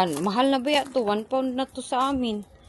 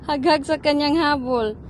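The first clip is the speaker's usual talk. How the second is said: ordinary talk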